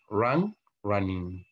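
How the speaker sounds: run, running said slowly; slow, clear teacher demonstration; slow model reading. Shown as a demonstration. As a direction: spell it out slowly for the class. Teacher modelling English verb forms.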